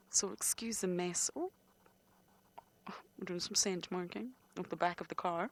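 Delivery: ordinary delivery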